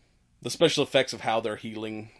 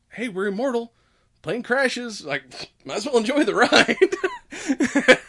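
high, whooping devil-may-care imitation; mock carefree glee; 11 semitones higher, 6 dB louder, slower than usual. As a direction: stretch the last words into a laugh. Acting carefree immortal before crash.

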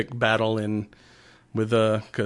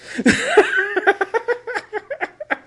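big high burst of laughter. Real, not acted. Laughing at the scene.

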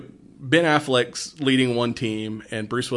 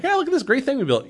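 mock boast; 13 semitones higher, faster than usual. Mocking the scientists' boasting.

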